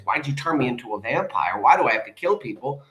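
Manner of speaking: usual speaking voice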